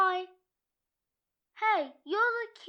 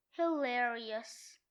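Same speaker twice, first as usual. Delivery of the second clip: deadpan sarcasm; 6 semitones lower, 4 dB quieter, slower than usual. Acted. Deadpan after joke about himself.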